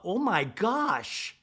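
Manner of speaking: high, drawn-out offended gasp of words; imitated affronted reaction, slow and high; mock shock. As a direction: stretch it out in mock disbelief. Acts Brittany's offended reaction.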